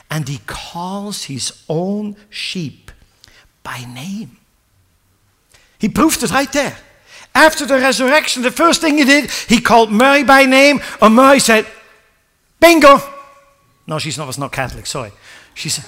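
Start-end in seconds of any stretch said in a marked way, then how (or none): 0.1-4.4 slow, whispered quote; hushed whisper. Acted. Preacher whispering Jesus's words to the congregation. 5.8-11.7 high, loud preaching build; fiery preaching. Acted. Preacher driving the story home to the congregation, fiery. 12.6-13.0 high, loud triumphant shout; joyful cry. Acted. Mary hearing her name, shouting in joy.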